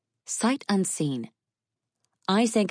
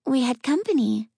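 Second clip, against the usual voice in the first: put-on high breathy child voice, murmured; 8 semitones higher, 3 dB louder than usual. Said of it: "a breathy voice murmured".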